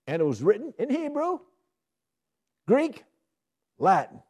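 loud, high, building list; excited proclamation. Acted. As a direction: announce each language with wonder. Preacher exclaims the languages.

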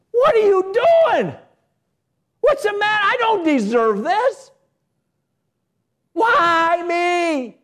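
high, loud, slow wail of self-pity; proud whining complaint. Retold or imitated. Proud complainer crying to the Lord, high whining wail. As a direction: whine at God like you've been wronged.

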